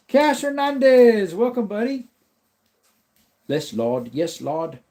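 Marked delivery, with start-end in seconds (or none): 0.1-2.1 high, slow, drawn-out call of welcome; excited greeting. Real, not acted. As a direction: call his name out like a host greeting a fan. Live stream greeting a new viewer.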